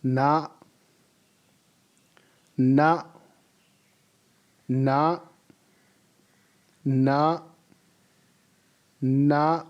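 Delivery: "na" said short and steady; model pronunciation. Shown as a demonstration. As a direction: give "na" as a clear model. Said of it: "We are going to pronounce it as".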